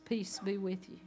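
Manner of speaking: a hushed, gentle "Peace be with you"; soft peace greeting. Acted. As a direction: breathe the blessing quietly. Jesus greets Thomas softly.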